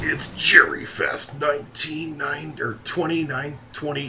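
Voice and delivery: high, loud event announcer voice; big put-on announcer call; dungeon boss announcer. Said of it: "doing the dungeon boss announcer voice".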